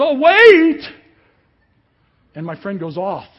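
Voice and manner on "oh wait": mock scream. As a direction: imitate a panicked shout, very high and loud. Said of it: "this guy in the back screaming".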